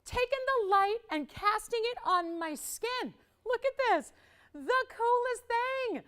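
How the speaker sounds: high and bright with wonder, lightly slowed; host gushing over light on her skin; delighted awe. Real, not acted. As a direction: marvel at the sparkle as if seeing it fresh. Host marvels at sparkle on skin.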